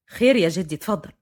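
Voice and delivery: low-pitched male figure, even; young man's voice. A narrator's reading. Fahd answers his grandfather, low and even.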